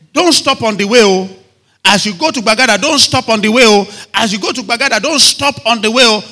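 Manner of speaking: loud, high repeated warning; urgent warning. Acted. Sender warns traveller going to Bagada, urgent warning. Acted warning before a journey.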